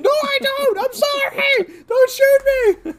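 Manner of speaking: high, slow, loud shrieked begging; terrified pleading. Retold or imitated. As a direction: plead for your life, drawn out. Driver begging cops not to shoot.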